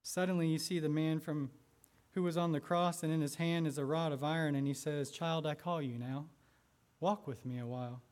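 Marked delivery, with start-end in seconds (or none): 5.1-8.0 gentle call. A narrator's reading. The risen man calls the lost child, softly and lower. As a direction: lower and softer, tender.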